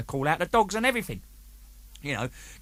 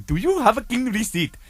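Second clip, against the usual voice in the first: put-on high Indian-accented demand; officer's questioning in Indian accent; 7 semitones higher than usual. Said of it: "So the guy said".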